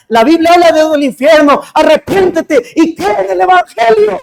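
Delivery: imitating a child preaching, rising to a shout; a little girl preaching fervently to her friend. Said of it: "le empezó a predicar".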